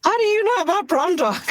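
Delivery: amazed question. Retold or imitated. Doctor amazed a stranger knows her dog, high astonished question. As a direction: squeal it out in happy surprise. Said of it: "I was like".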